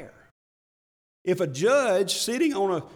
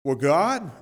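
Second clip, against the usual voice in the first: raised, louder mock defiance toward God; defiant challenge; 7 semitones higher, 6 dB louder than usual. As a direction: challenge God like you know better. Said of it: "with a defiant attitude".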